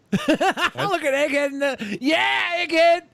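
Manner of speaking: high laughing shout at the screen; excited shout. Real, not acted. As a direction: yell it out, laughing. Shouting at the video.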